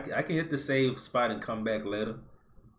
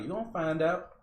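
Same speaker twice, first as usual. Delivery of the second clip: high, rising taunt; knowing singsong comeback with a lifted ending; teasing warning; 6 semitones higher than usual. Retold or imitated. Talking back to people who call astrology a crock, teasing warning.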